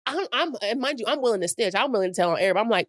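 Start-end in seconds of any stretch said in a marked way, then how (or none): none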